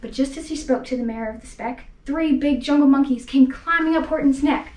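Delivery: usual speaking voice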